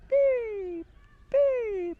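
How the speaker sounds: very high, loud contented chick chirps; happy bird beeps. Acted. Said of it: "He was really happy".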